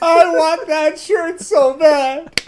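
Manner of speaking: slow, high whiny mock sob; hammed-up crying plea. Joking longing for a shirt.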